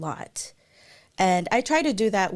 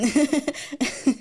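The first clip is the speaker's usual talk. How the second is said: a short, real, delighted laugh; light laugh; 6 semitones higher than usual. Real, not acted. Flattered by a viewer's compliment in the chat, light laugh. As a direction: laugh it off, pleased and a little shy.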